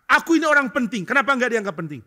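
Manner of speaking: high, loud indignant outburst; offended anger. Retold or imitated. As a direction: act out a proud man who feels slighted. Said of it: "Dia mulai merasa marah". (ledakan marah tersinggung, tinggi dan keras)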